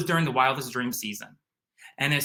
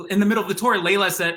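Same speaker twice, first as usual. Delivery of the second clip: usual speaking voice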